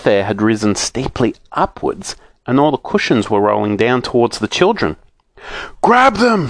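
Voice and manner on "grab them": higher, urgent call; shout. A narrator's reading. Joe urging the others, shouting. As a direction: yell it to the others. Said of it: "shouted Joe".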